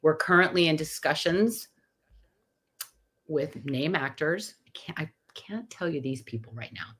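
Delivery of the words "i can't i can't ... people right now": secretive whisper. Pretend. Keeping actor names secret from the host, whispered.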